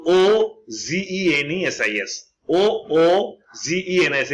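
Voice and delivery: slow deliberate spelling for students. Shown as a demonstration. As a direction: spell O O G E N S letter by letter, slowly. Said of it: "বানানটা হচ্ছে".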